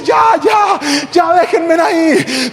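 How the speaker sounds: high, shouted plea to stop; pleading thief. Acted. Thief to the mob chasing him, high desperate plea. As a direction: cry out to be left alone, high and stretched. Fleeing thief begs to be left.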